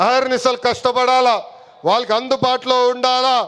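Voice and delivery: full-throated political rally shout; rally oratory at full force. Real, not acted. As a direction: rouse the party workers to the rafters. Rally climax shouted to cadres.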